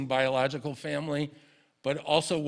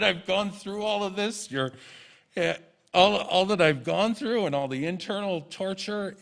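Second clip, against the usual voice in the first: higher, half-laughing retold indignation; indignant protest; 6 semitones higher, 4 dB louder than usual. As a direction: protest at your mom, still half laughing. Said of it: "I got really indignant".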